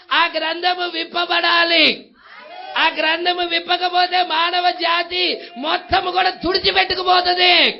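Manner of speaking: urgent warning cried from the pulpit; stretched, climbing sermon cry; full-force preaching. Acted. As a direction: build to a high, loud shout.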